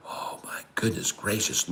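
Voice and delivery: breathless whispered amazement; awed whisper. Real, not acted. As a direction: whisper it in awe. Awed exclamation over the chart.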